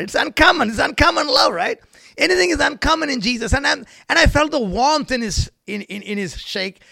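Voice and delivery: high, loud pulpit proclamation; fervent preaching. Real, not acted. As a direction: proclaim it to the whole congregation. Preaches at full force.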